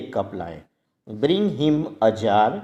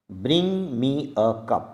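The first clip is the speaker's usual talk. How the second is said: slow model sentence; much slower than usual. Shown as a demonstration. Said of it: "model sentence for learners".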